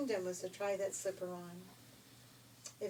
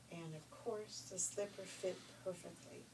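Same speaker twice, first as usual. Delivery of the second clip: plain reading voice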